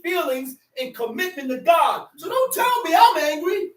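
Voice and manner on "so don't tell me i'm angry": high, forceful protest from the pulpit; animated preaching. Real, not acted. Preacher telling listeners he isn't angry, animated and high. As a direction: insist on it with raised pitch. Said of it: "you think I'm yelling at you and screaming".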